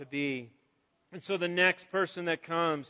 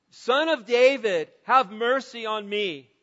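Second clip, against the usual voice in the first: retold shout; 2 semitones higher, 5 dB louder than usual. Blind man calling to Jesus passing by, shouting out. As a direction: shout it as a plea, easing off at the end. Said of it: "he just shouts out".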